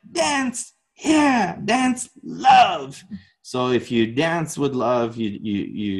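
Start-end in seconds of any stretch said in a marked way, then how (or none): 0.1-3.0 high, slow, drawn-out mentor voice; Miyagi-like teacher. Retold or imitated. Teacher delivers his lesson to dancer, intense Miyagi-like voice. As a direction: imitate an intense master handing down a lesson. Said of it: "almost like a Miyagi moment".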